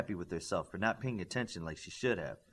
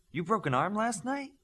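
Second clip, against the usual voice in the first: much higher, rising astonished question; startled question; 11 semitones higher than usual. A narrator's reading. Teacher hearing of a student's broken arm, surprised. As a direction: let the pitch leap up in disbelief.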